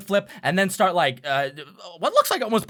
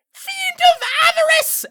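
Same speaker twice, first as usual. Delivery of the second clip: very high, loud imp shout; imp actor's battle cry; 5 dB louder than usual. Acted. GM as imp hurling a knife at the stage, shrill battle cry. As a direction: squeal out a theatrical challenge. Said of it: "and then go".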